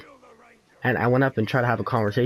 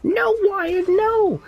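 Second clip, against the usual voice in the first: very high mock cries of protest; imitated high-pitched frantic pleading; Garrett; 4 dB louder, much slower than usual. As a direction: cry out in a squealing put-on kid voice. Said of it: "I hear a bunch of".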